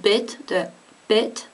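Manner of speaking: bit with the lax English i; bit, the bit, said slowly and deliberately. Shown as a demonstration. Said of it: "as in a, a little bit".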